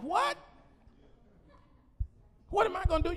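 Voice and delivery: high startled what; shocked. Retold or imitated. Preacher stunned when God turns the question on him, startled. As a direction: caught off guard, echo it back.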